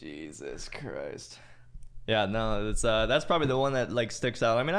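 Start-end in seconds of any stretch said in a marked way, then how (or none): 0.0-1.4 very slow, low and soft; hushed, drawn-out disbelief; appalled aside. Retold or imitated. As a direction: draw it out under your breath. Appalled reaction to the yelling.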